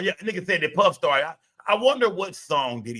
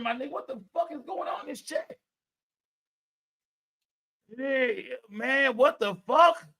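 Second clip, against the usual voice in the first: shocked disbelief; 6 semitones higher than usual. Real, not acted. Streamer reading his chat's porn titles, stunned high disbelief.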